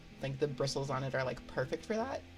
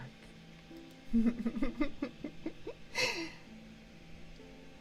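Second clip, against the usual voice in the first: high, breathy drawn-out giggle; playful spooky giggle; 11 semitones higher than usual. Pretend. Streamer as friend in the dark, long high giggle.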